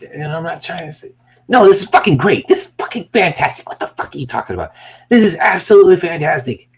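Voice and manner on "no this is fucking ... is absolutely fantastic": loud, high burst of insistent hype; emphatic rave. Pretend. Creator insisting to viewers the work is great, emphatic rave. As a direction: rave about it, punching the praise. Corrects himself with hyped mock outburst.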